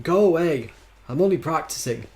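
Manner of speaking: loud, high shout in attempted Scottish; bad Scottish accent. A narrator's reading. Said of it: "my terrible attempt at a Scottish accent".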